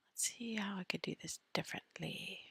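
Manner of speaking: whispered aside to herself; soft unvoiced aside. Real, not acted. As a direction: breathe it out, thinking aloud.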